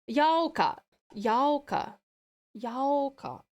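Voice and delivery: YAUKA with a drawn-out "yow" first syllable; trying out Yauka. Shown as a demonstration. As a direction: say "Yauka" slowly as YOW-kah. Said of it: "Like that?".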